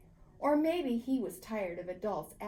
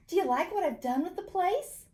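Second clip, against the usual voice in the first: proud excited question; 8 semitones higher, 4 dB louder than usual. A narrator's reading. Paxton proudly shows house.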